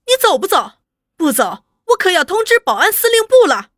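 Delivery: 提高音调，大声喝问，怒气冲冲; 把人赶走的厉声威胁; angry threat. A narrator's reading. 玉华怒逐吴启超. (高声怒喝)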